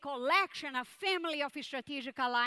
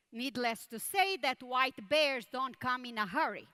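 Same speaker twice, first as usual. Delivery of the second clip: ordinary talk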